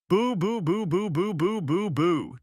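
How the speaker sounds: high, jeering boo boo boo; mocking boos. A narrator's reading. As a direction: boo her down like a taunting crowd. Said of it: "Judy shouted".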